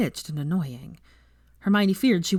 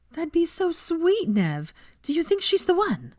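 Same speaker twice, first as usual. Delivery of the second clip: swooning gush; 12 semitones higher, slower than usual. A narrator's reading. Veronica swooning.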